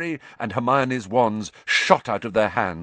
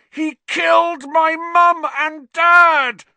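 much louder, higher, slow furious roar; 10 dB louder, slower than usual. Acted. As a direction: bellow it with all his hate. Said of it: "Harry roared".

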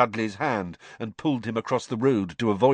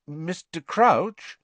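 cautious; 7 semitones higher, much slower than usual. Acted. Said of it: "said Harry cautiously".

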